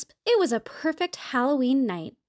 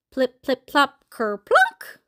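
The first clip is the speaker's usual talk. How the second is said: dropping treats; 4 dB louder, slower than usual. A narrator's reading. Mabel empties all her treats out, comic dropping sounds.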